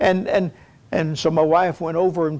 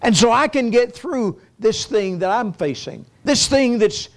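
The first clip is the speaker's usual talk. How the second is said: rising, fervent sermon delivery, higher pitched; preaching; 4 semitones higher than usual. Acted. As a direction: preach it out to the congregation, building. Preacher builds to exhortation.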